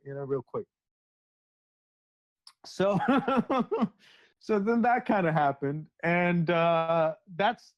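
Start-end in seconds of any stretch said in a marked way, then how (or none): 3.0-3.9 high, bubbling laugh; giggle. Real, not acted. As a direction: break into a short giggle. Laughs mid-story.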